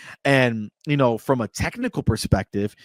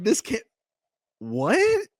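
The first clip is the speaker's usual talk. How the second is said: much higher, incredulous "what?"; stunned disbelief; 11 semitones higher, slower than usual. Retold or imitated. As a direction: sound floored by the twist. Retelling disbelief at ending.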